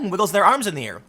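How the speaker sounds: ordinary talk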